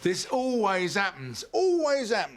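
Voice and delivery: high, rising exasperated complaint. Acted. Agent to client fallen in love again, exasperated.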